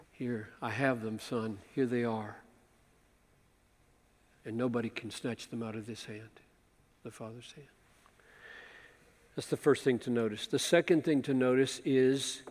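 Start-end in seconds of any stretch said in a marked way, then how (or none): 0.1-7.7 soft, low, gentle handing over; quiet fatherly tenderness; tender. Acted. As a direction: speak like a father giving a gift. Father voiced handing sheep.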